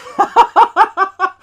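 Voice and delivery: high, loud burst of laughter; big delighted laugh. Real, not acted. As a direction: crack up at your own joke. Laughs at Bond reference.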